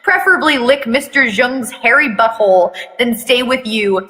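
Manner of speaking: plain reading voice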